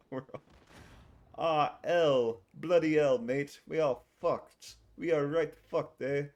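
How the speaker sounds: put-on drunken British accent. Streamer loudly riffing a British accent at the game. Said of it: "You want me to do a British impression?".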